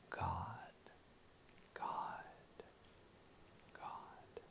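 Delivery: soft unvoiced whisper. Shown as a demonstration. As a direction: whisper the name, fading away. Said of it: "I will whisper".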